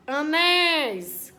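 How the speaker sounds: "Anéis" slow, "néis" drawn out; stressed final syllable. Shown as a demonstration. Teacher showing an oxytone, "Anéis" with a long emphatic last syllable. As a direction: stretch and push the end of "Anéis". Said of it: "qual é a sílaba mais forte".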